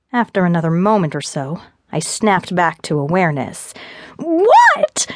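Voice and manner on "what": very high, loud angry scream; screamed retort. A narrator's reading. Fiona snaps back to awareness at Gabe, screamed retort. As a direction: scream the word in fury. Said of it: "I screamed".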